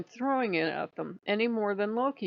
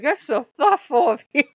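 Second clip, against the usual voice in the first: much higher and louder, gushing thanks; touched, grateful Demon; 8 semitones higher, 10 dB louder than usual. Retold or imitated. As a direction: play it falsely touched and sweet. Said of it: "And Demon would be like".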